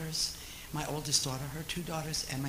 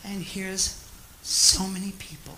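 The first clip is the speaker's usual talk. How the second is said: usual speaking voice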